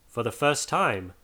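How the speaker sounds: ordinary delivery, nothing marked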